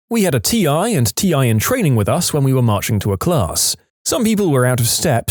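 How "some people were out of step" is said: ordinary delivery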